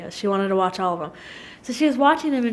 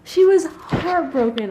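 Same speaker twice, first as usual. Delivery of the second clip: high, drawn-out aching emphasis; heartfelt sympathy; 8 semitones higher, slower than usual. Real, not acted. Mother telling of her sad daughter, heartfelt sympathy. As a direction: stretch it out with pity.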